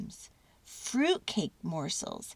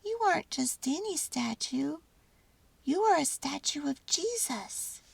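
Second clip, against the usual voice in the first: high mouse voice, soft and sighing; a tiny mouse understanding at last; 8 semitones higher, 4 dB quieter than usual. A narrator's reading. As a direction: mouse realising who the baby is. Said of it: "sighed Mortimer".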